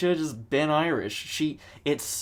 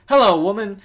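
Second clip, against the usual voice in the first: loud, higher hammed-up hello; loud mock greeting; 7 semitones higher, 12 dB louder than usual. Reacting to the woman on screen, loud mock greeting. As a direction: call it out like a dumbfounded viewer. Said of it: "so I'm like".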